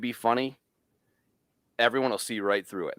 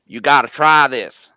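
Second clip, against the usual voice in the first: loud, drawn-out put-on accent; bad Beeman accent; 3 semitones higher, 10 dB louder, slower than usual. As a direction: push a friend's accent, loud and slow. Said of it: "I'm doing a bad Beeman accent".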